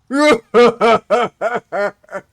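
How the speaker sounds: big, loud villainous belly laugh; deep belly laugh. Acted. Game master sounds a laugh heard nearby, deep belly laugh. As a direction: let out a booming, rolling laugh. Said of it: "a deep belly laugh".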